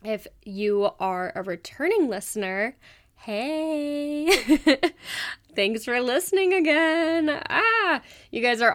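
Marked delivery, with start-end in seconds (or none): none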